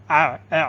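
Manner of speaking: آ squeezed from the throat; throat articulation. Shown as a demonstration. Teacher shows where the sound comes from, throat-made آ. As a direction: isolate the throaty آ of ain. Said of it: "یہاں سے نکلتا ہے".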